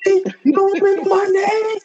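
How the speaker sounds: high, strained comic shout; mock outraged yell. Friend acting the anime villain, high outraged yell. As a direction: yell it like a wounded ego. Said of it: "acting a character's outburst".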